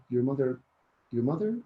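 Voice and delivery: "mother?" lifted at the end as a question; rising intonation. Shown as a demonstration. Teacher prompting student's self-correction, rising intonation. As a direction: leave "your mother?" hanging on a rise. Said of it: "with a rising intonation".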